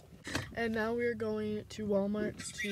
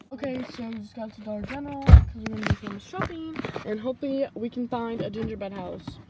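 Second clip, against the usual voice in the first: haul video; 10 dB louder, faster than usual. Real, not acted. Arrives at store to shop.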